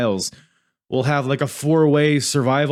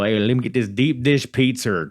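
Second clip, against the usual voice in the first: bad put-on Chicago accent. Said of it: "That's a terrible Chicago accent".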